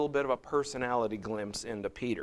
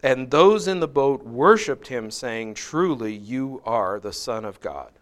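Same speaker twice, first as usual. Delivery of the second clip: raised, measured Bible reading; slightly louder scripture reading voice; 6 dB louder than usual. Acted. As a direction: read the passage out to the class. Reads scripture passage aloud.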